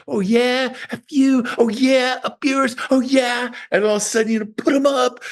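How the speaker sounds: high-pitched mock taunting; hammed-up tough talk, pitched well up; belligerent fool. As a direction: goad them like a fool itching for a fight. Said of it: "Any fool could just say".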